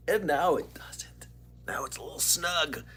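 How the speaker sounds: whispered confession; whispering. Real, not acted. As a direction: whisper it like a secret. Confessing jersey no longer fits.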